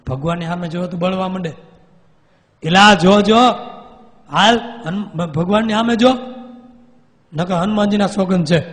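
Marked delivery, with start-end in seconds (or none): 2.6-8.6 loud, raised order; commanding shout. Retold or imitated. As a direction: force him to look. Swami commands ghost to look.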